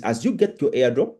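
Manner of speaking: ordinary delivery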